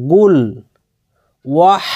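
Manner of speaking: normal talking voice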